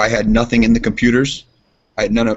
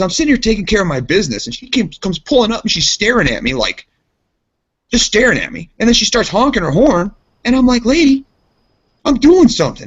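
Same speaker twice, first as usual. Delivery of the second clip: high-pitched imitation of an indignant friend; worked-up retelling; 11 semitones higher, 4 dB louder than usual. Mad friend recounting gas pump run-in, indignant imitation. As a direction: build in pitch and volume, imitating his grievance. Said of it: "he was mad as hell".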